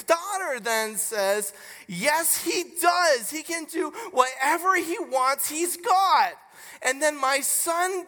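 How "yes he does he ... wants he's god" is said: imitating a child arguing back, high and loud; mock sibling squabble, emphatic comeback; acted insistent retort. Acted quarrel, daughter insists back.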